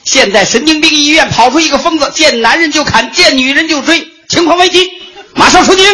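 high urgent commanding shout; brief the squad like a stiff officer; stiff barking toy soldier. Acted.

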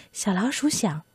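plain reading voice

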